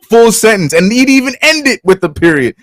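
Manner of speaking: high, drawn-out griping; slow, high-pitched, emphatic protest; exasperated complaint. Real, not acted. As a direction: stretch the words out in comic frustration. Complaining that the post is unreadable.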